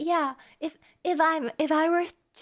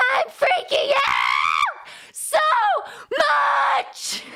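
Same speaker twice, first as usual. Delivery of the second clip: shrieking higher and louder, frantic over the scent; hysterical fangirl; 9 semitones higher, 6 dB louder than usual. Acted. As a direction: play a fan losing her mind over Yui's smell. Reads a hysterical Yui fan text.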